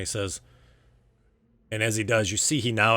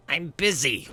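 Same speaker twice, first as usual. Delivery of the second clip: high annoyed brush-off; irritated boss; 8 semitones higher, 3 dB louder, slower than usual. Acted. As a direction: wave him off, higher. First refusal.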